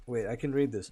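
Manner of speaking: ordinary talk, nothing marked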